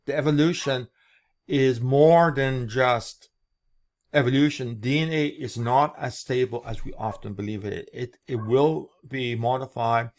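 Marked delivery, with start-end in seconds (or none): none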